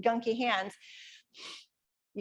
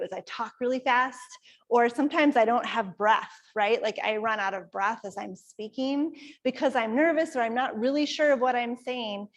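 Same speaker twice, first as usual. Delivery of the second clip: usual speaking voice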